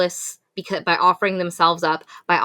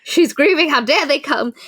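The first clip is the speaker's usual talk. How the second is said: mock indignation; 8 semitones higher, 4 dB louder than usual. Mock-voicing Elle's outrage.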